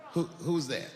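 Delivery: ordinary talk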